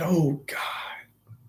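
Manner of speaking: breathless whispered oh God; a stunned whisper; whispered gasp. Retold or imitated. Mother hearing her grandson was shot, whispered. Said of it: "And she said".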